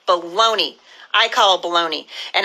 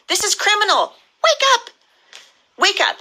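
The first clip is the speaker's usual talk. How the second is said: outraged cry; 10 semitones higher than usual. Real, not acted. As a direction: pitch leaps much higher, urgent wake-up call. Outraged call to wake up.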